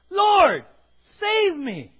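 high, loud cry for rescue; desperate cry. Acted. Peter sinking in the waves, crying out loud. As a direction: shout it like a sinking man.

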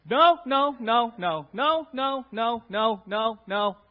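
loud stern string of no; scolding no after no; repeated no's. Pretend. Preacher mocks a church of constant no, loud scolding. Word picture of Christianity as no's.

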